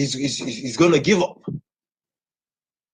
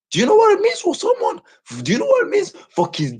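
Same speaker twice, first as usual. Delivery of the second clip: angry shout; 13 semitones higher than usual. Real, not acted. Furious rant about viewers and lions.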